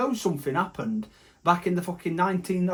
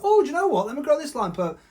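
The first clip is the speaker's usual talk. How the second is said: squeaky mock voice; 9 semitones higher, faster than usual. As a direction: go even higher and squeakier. Voicing nature, highest.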